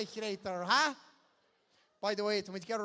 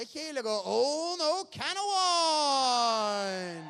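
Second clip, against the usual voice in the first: higher, loud, stretched call; excited shout; 6 semitones higher, 10 dB louder, much slower than usual. Real, not acted. MC reacting to a drawn ticket, excited shout. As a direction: cry out in mock dismay.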